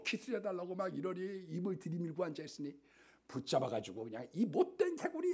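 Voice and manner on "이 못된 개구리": 높게 쏘아붙이는 화난 공주; 짜증 내며 개구리를 꾸짖듯. Acted. Princess throws frog at wall. (개구리를 벽에 던지는 공주의 화난 높은 외침)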